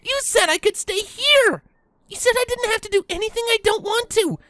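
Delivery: put-on high, loud desperate shout of a young filly; filly yelling. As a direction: yell it out, pleading and betrayed. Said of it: "The filly yelled".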